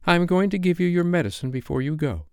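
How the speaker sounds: put-on a mild motherly girl voice; Wendy's voice. A narrator's reading. Wendy voiced, giving medicine.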